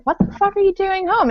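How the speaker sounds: high-pitched retold bewilderment; startled disbelief. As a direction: ask it as if caught off guard. Retelling her startled reaction to dad.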